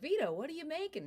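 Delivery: a hint of a curious coworker calling over; light character voice asking about dinner; Bob asks. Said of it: "and Bob's like".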